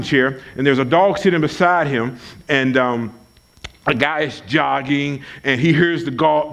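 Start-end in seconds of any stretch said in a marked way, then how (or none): none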